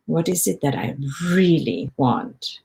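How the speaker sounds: "really" stretched out in a probing question; stress on "really". Shown as a demonstration. Coach modeling a question to oneself, stress on "really". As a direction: linger on "really" as you ask. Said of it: "I emphasize the word really".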